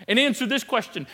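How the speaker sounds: ordinary talk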